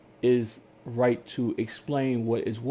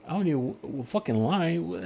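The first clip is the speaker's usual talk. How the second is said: normal talking voice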